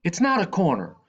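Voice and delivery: guard disputing the corner from the roof; calling. A narrator's reading. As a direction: call it down from the rooftop. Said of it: "Badu calls from the rooftop".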